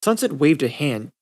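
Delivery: usual speaking voice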